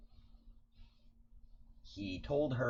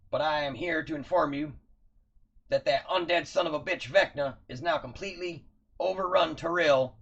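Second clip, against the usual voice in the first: high, loud formal soldier report; stiff barking toy soldier; 11 semitones higher, 6 dB louder than usual. Acted. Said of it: "and he says".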